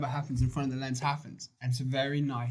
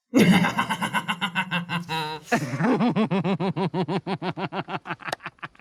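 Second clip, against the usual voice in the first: loud laughter; 6 semitones higher, 7 dB louder than usual. Real, not acted. Friends joking about impressions.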